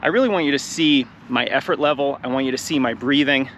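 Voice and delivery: ordinary talk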